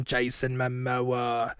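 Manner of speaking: slow, drawn-out character voice; slow impersonation of a big deli man; Jason Momoa. Acted. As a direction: stretch the words out in a put-on voice. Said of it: "It's me, Jason Momoa".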